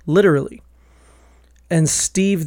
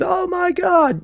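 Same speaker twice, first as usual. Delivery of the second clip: much higher, squealed disbelief; a Philly local appalled by the answer; mock outrage; slower than usual. Philly guy reacting to cheesesteak answer.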